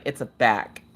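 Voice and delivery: low, soft deadpan grumble; lightly voiced grumpy husband. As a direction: mutter it low and unimpressed. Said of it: "And he's like".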